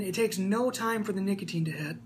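normal talking voice

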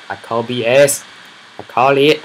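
real frustrated outburst; indignant shout. Real, not acted. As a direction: call it out loudly. Frustrated about spawns.

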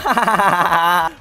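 high, loud burst of laughter; gloating laugh. Real, not acted. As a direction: laugh hard at the opponent. Laughing at opponent's death.

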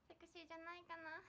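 usual speaking voice

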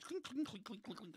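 soft, quick clinks going up the stairs; clinking sound effect, kept light; imitated clinking steps. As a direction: imitate the clink of climbing flight after flight. Mimicking steps up ten flights.

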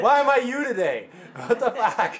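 high-pitched, incredulous complaint; exasperated whine. Real, not acted. Player to laughing friend over getting hit, exasperated.

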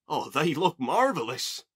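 loud, drawn-out delight; chirpy. A narrator's reading. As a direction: gush slowly with full-voiced enthusiasm. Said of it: "Oliver chirped".

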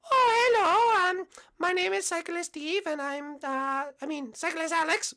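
put-on high falsetto girl voice; hesitant, high-pitched contestant voice; cyclist contestant. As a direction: introduce yourself in a put-on falsetto.

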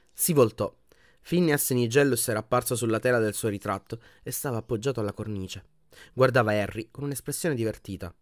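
ordinary delivery, nothing marked

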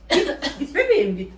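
loud high shoo call. Real, not acted. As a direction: chase the kitten off loudly. Shooing the cat, loud.

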